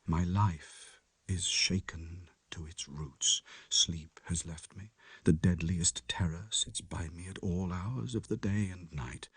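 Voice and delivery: soft, low and shaken confession; hushed, haunted narration. A narrator's reading. Lanyon writing his last letter to Utterson, hushed. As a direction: read it like a dying man's last words.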